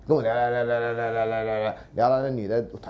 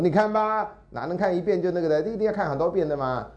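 triumphant told-you-so; 7 semitones higher than usual. Retold or imitated. Teacher lectures student triumphantly.

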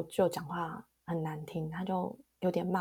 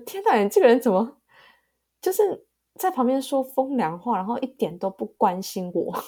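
louder, hammed-up indignant mother; mock exasperated outburst; 5 semitones higher, 8 dB louder than usual. Mother retelling how hurt she felt, louder mock outrage. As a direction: imitate a hurt mom snapping at her daughter. Mom retells her upset reaction.

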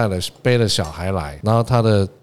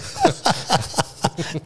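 soft laugh; 7 semitones higher, 4 dB quieter than usual. Real, not acted. Laughs at hair joke.

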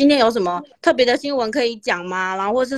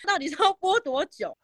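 angry listener complaint; 7 semitones higher, 4 dB quieter than usual. Retold or imitated. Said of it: "打来骂说".

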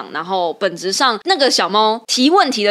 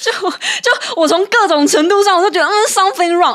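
high, louder exasperation at the whole mess; exasperated; 9 semitones higher, 4 dB louder than usual. Real, not acted. Host venting to co-host about the member, exasperated. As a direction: throw up your hands, this is just wrong.

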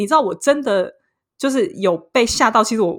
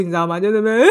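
ordinary talk, nothing marked

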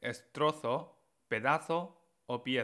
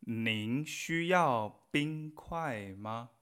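slow, drawn-out Mandarin model sentence; frase modelo lenta; much slower than usual. Shown as a demonstration. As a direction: model it syllable by syllable for learners. Teacher models a Chinese phrase. (frase en chino pronunciada muy despacio)

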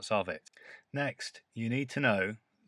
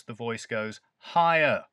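slow and loud, "higher" lifted; stress on higher; 5 dB louder than usual. Shown as a demonstration. Teacher showing learners how stressed words rise. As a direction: push "higher" up and out. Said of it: "For words which we stress".

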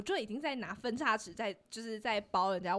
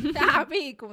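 laughing; 5 semitones higher, 10 dB louder, slower than usual. Real, not acted. Mocking nickname with laugh.

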